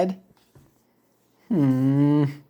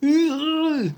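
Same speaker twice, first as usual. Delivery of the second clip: plain reading voice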